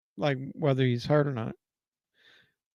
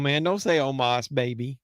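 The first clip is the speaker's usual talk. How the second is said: normal talking voice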